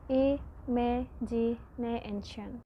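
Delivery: "i, me, ji, ne, and shen" with a pause between syllables. Shown as a demonstration. Student teaching the class, splitting "i, me, ji, ne, and shen" into separate syllables. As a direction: pull imagination apart into beats. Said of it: "Imagination has five syllables".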